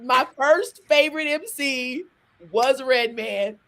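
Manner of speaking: imitating everybody. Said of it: "everybody's like".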